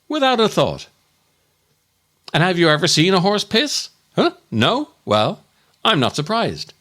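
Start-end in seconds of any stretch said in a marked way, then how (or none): none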